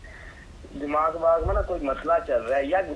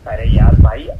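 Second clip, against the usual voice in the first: loud, fed-up groan of a cry; exasperated outburst at the customer; 7 semitones lower, 15 dB louder than usual. Real, not acted. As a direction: throw up your hands and shout. Shopkeeper loses patience with absurd order.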